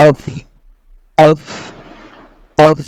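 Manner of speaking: "অফ" as Arabic qaf from inside the throat. Shown as a demonstration. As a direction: start "অফ" far back, not as Bengali k. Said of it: "এটা আপনাকে গলার ভিতর থেকে". (গলার ভিতর থেকে "অফ")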